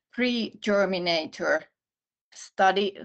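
ordinary delivery

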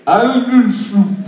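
slurry foreign-word demo; drunk-sounding. Shown as a demonstration. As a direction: draw out "Onion soup" like a drunk. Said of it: "It sounds like someone's really drunk".

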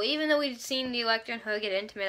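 usual speaking voice

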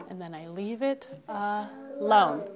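drawn-out rule line; slow it down to teach. Shown as a demonstration. Teacher stating the rule slowly. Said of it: "slow rule phrase".

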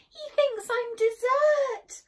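high, loud, drawn-out wail; frightened cry. A narrator's reading. Boy tells mum his peril, crying out. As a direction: wail it in panic. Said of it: "cried Eddie".

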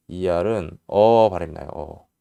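usual speaking voice

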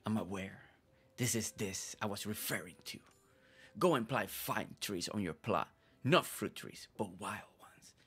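low, hushed, brooding character voice; deep scary voice. Acted. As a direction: play a secretive dramatic villager. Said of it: "Always with that deep voice".

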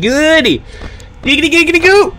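high, giddy Quagmire catchphrase; Quagmire impression. Streamer blurting to chat, sudden high Quagmire giggity. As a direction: slide up into a leering Quagmire giggity. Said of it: "I just turned into Quagmire for a second".

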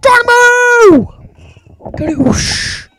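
very high, loud Geronimo; full shout. Real, not acted. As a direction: scream the launch cry. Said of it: "It's my thing".

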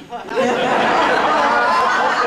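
a loud, high burst of laughter at the punchline; big laugh. Real, not acted. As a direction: laugh out loud at your own joke. Punchline of pill joke.

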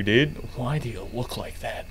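ordinary delivery

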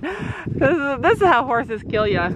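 usual speaking voice

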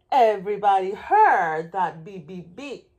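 ordinary delivery

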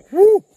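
high loud whoop from the heat; burning mouth. Real, not acted. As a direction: gasp it out as the spice hits. Reacting to hot pepper bean.

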